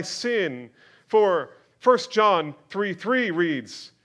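loud, high, slowed preaching build; fiery pulpit delivery, loud and high; full-force preaching. Acted.

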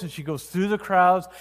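normal talking voice

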